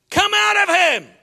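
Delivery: full-voiced commanding scream. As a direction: scream the order like an exorcist. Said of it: "I screamed".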